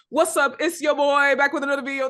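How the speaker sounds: hyped-up YouTuber greeting; loud, high YouTuber intro. Retold or imitated. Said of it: "that type of content creator that's like".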